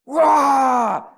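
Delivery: very loud, high mock roar; imitated warrior roar. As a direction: let out a boy's battle cry. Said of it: "He had to roar".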